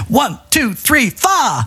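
shouted Liverpudlian one two three four, pitched high; Liverpudlian count-in. Retold or imitated. Host mimics [name]'s count-in on the record, shouted in a Liverpudlian accent. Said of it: "he's doing it in a Liverpudlian accent".

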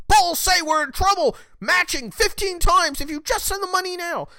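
hammed-up desperate donation plea; mocking fundraising text. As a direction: parody a nagging campaign text in a shrill, high whine. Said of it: "something along the lines of".

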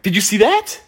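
startled, demanding an answer; alarmed. Retold or imitated. Spots another fly, more alarmed.

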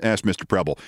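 usual speaking voice

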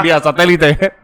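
high, slow hammed-up presenter; mock announcer intro. Hosts introduce a fake phone guest in a hammed-up announcer voice. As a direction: announce the caller grandly.

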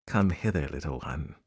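low, soft coaxing creature; luring crocodile. Acted. Crocodile lures the elephant's child.

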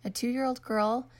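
ordinary delivery

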